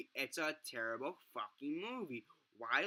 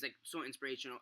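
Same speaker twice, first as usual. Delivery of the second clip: usual speaking voice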